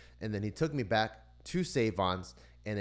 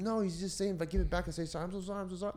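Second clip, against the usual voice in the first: high, mock-crying kid apologizing; tearful pleading; 11 semitones higher, faster than usual. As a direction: beg in a high whimpering imitation. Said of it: "like, crying and shit like that".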